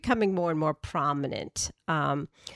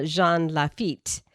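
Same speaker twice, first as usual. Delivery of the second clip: ordinary talk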